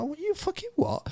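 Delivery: very high, softened mock squawk; incredulous mum. Mum hearing son is moving home, imitated high and incredulous. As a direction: imitate a mother in high-pitched disbelief. Mum's shocked reply, acted.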